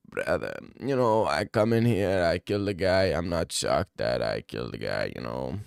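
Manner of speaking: deep, calm fighter bravado; imitating Hamza. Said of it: "He's probably said".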